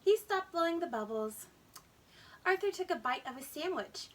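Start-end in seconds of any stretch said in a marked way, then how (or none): none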